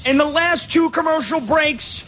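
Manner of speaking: Ron Jaworski impression. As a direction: do a Frank Caliendo style Jaworski. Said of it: "that was like my Frank Caliendo impression of Ron Jaworski".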